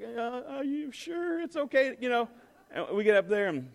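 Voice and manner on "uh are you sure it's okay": higher, slow and worried question; a scared kid asking for reassurance; nervous little boy. Retold or imitated. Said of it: "Parker's like".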